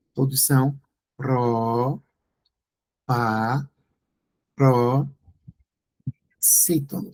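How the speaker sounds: "pró-pa-ró-cítono" split into slow separate syllables. Shown as a demonstration. Teacher to exam students, slow syllable-by-syllable demo. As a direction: spell out "pró-pa-ró-cítono" beat by beat. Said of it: "sílaba mais forte vai ser sempre a antepenúltima".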